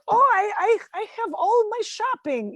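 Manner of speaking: put-on high-pitched mom with a Russian accent; Soviet mom accent. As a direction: imitate your Russian-accented mother protesting. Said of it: "my mom's from the Soviet Union".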